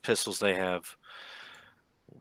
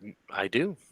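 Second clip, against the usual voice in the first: halting reply with a false start; reluctant, faltering admission; hesitant. Acted. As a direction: stammer slightly, then commit uncertainly. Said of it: "very hesitantly says".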